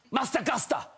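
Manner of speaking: high-pitched imitation of an insistent three-year-old; demanding toddler. Three-year-old daughter demands her film from dad, demanding toddler. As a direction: voice a small child's shrill demand.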